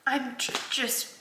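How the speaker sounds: mom choking up over her son's graduation, tearful; tearful, holding back. Real, not acted. Real tears, fighting to keep talking. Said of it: "I knew I was gonna cry".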